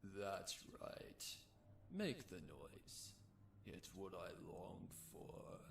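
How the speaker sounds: low, slow, half-whispered menace; sinister cultist. Acted. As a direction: savour the victim's cries. Cultist gloats while torturing.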